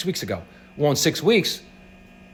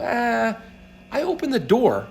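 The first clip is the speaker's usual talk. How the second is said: high-pitched offhand dismissal; a higher, self-minimizing "it was nothing" voice; small-role shrug; 9 semitones higher, slower than usual. Acted. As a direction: play it small, high and modest. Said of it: "play this small role and go".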